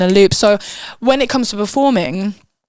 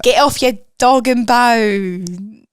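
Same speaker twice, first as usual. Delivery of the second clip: mock Cockney; 2 semitones higher, 4 dB louder, much slower than usual.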